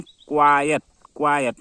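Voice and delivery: क्वाइट as kwaa-yat, two clear syllables; quiet with ya. Shown as a demonstration. Teacher repeating for students, क्वाइट said kwaa-yat. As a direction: bring out the ya inside each क्वाइट. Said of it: "य का उच्चारण होता है".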